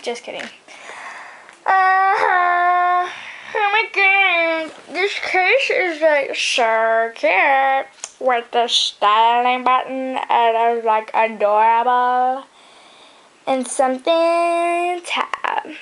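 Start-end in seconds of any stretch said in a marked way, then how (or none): 1.6-7.9 high, very slow put-on gush; hammed-up gushing. Hammed-up gushing over phone case. 8.2-12.5 slow put-on voice, vowels dragged out; hammed-up gushing. Hammed-up gushing over phone case. 13.5-15.8 high, slow and drawn out; playful put-on voice. Outtake clowning in put-on voice.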